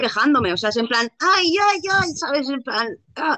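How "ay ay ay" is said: pained lament. Retold or imitated. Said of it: "es como que duele eso".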